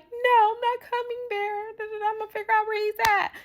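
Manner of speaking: mock whiny friend. Teller mimics her friend calling her extra, in a high whine. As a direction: make fun of the friend's fuss.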